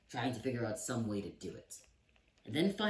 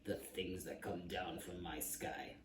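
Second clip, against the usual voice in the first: a lightly voiced old king, muttering low and soft; mild muttering old king; grumbling king; 3 semitones lower, 3 dB quieter than usual. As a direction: sputter it under your breath like a grumpy old king. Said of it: "muttering and sputtering".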